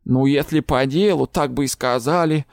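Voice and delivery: a lightly voiced goblin, grumbling. A narrator's reading. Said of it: "буркнул гоблин". (ворчливый гоблин, слегка озвученный)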